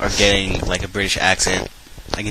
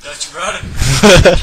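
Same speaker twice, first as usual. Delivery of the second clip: mock announcement; 9 semitones higher, 10 dB louder, much slower than usual. Crude joke shouted out.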